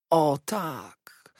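high, honeyed assent; sugary sweet. A narrator's reading. Heep agreeing after pounding the table, sickly sweet. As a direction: coo it with false sweetness. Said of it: "ciągnął słodziutkim głosem".

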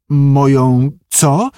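drawn-out, puzzled question; slow, incredulous query; taken aback. Acted. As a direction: stretch the words in disbelief. Taken aback by her accusation.

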